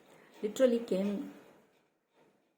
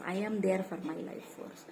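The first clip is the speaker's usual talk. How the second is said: ordinary delivery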